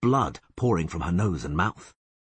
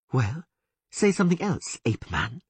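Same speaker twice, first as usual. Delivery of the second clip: higher, mocking elf woman hissing; 8 semitones higher than usual. A narrator's reading. Elf woman taunting the bound Witcher, higher sneer. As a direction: taunt the bound man, pitched up. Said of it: "she hissed".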